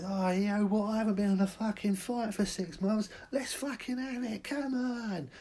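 high, rowdy come-on for a brawl; drunk fight challenge. Acted. Drunk lad in the street picking a fight, rowdy. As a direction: spoil for a fight.

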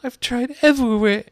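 high-pitched mock-sobbing pigeon; hammed-up plaintive lament; kind of sob. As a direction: wail it in exasperated despair. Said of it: "with a kind of sob".

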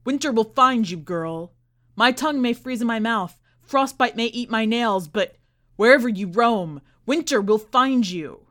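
loud, furious threat hurled after her; shrieking curse. Acted. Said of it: "Heinrich shrieks behind her".

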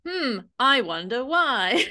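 slow, sing-song sarcasm; drawn-out pitch swoops, pointedly ironic; mock wondering. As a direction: imitate a knowing host pretending to ponder. Host's sarcastic reply to guest, imitated.

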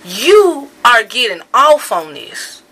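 loud, slow, furious accusation; angry accusation. Real, not acted. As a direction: hurl it at them slowly, outraged. Angry rant at the critics.

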